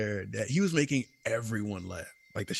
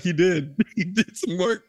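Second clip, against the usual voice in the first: laughing reply; 7 semitones higher, 5 dB louder than usual. Retold or imitated. Retelling own laughing reply.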